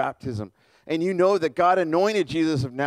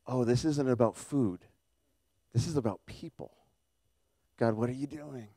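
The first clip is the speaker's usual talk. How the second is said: much softer, hushed inner thought; dawning awe, said to himself; quiet realization; 3 semitones lower, 7 dB quieter than usual. Acted. Peter piecing the vision together, quietly stunned.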